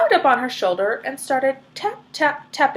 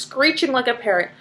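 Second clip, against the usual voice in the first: usual speaking voice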